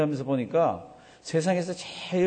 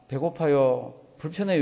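ordinary talk, nothing marked

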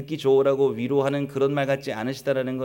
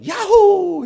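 high, loud mock whoop of joy; hammed-up cheer; 11 dB louder, much slower than usual. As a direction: whoop it out as a pretend celebration. 며느리들이 기뻐 외치는 상상. (과장되게 신나서 외치는 환호)

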